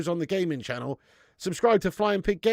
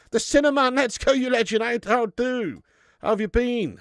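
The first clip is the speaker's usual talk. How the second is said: louder and higher, hyped shout-out; excited greeting; 7 semitones higher, 5 dB louder than usual. Real, not acted. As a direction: greet the viewer like a mate you're thrilled to see. Excited greeting to a chat member.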